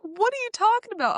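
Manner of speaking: squeaky mock disbelief; baffled boyfriend. Boyfriend baffled by car purchase.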